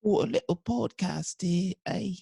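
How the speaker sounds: high, put-on Cockney accent, like Oliver Twist; mock Cockney. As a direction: play a cheeky Cockney orphan. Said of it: "now you just sound like Oliver the fuck Twist".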